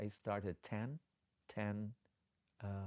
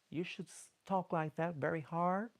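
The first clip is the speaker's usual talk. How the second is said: high, loud, forceful delivery; hard talking; 8 semitones higher, 6 dB louder than usual. Shown as a demonstration. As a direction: push it out hard, pitched up high. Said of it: "so you speak very hard".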